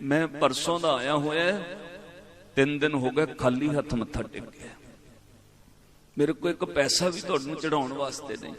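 softly voiced helpless plea; subdued, understated retold weeping; retold tearful confession. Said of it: "ਰੋ ਪਿਆ".

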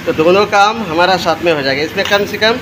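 ordinary delivery

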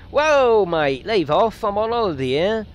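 loud, high indignant yelp at a driver; mock outrage. Tourist yelling at a reckless driver, mock outraged. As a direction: shout it like a tourist nearly run over. Car nearly hits his character.

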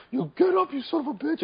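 high mock yell at a downed teammate; shout to revive. Shown as a demonstration. Mastermind yelling at a downed crew member, high mock shout. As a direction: bark them back to their feet. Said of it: "you can shout them to get up".

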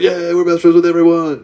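high, loud tipsy cheer; mock drunken whoop of friendship; drunken buddy cheer. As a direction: holler it like a drunk new best friend. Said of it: "We've been drinking all day".

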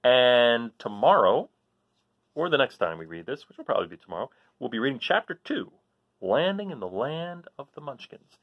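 ordinary delivery

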